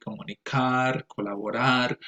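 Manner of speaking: comunicar, colaborar said as Spanish words; Spanish vowels, final syllable stressed in comunicar, colaborar; Spanish pronunciation. Shown as a demonstration. Teacher showing the Spanish source, comunicar and colaborar in Spanish. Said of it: "in Spanish we say".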